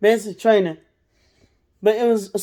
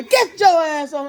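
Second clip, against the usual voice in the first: mock drill yell; 6 semitones higher than usual. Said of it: "somebody start yelling".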